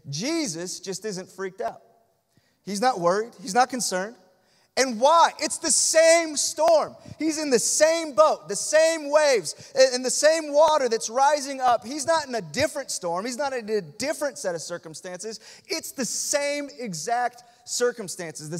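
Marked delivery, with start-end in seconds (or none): 4.8-13.5 rising, loud sermon peak; full-force preaching. Real, not acted. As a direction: hammer each same home to the congregation. Preacher building to a climax.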